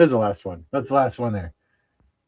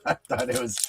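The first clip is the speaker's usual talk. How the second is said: laughing through words; 9 semitones higher, 5 dB quieter, faster than usual. Real, not acted. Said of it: "breaking into laughter".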